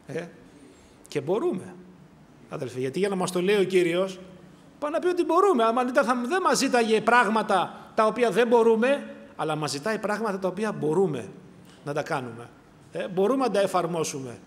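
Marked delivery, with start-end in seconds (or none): 4.8-9.1 higher and louder, driving the point home; raised preaching voice, insisting; fervent preaching. Acted. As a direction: preach it with conviction. Preaching at full force.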